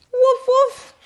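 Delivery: high playful woof woof; playful barking. Acted. Turkey pretends to be a dog, high yappy bark.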